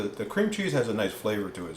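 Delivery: ordinary delivery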